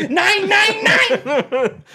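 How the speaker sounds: put-on high, loud German-accented refusal; mock German accent. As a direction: cry out in a hammed-up German accent, laughing. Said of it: "That's German".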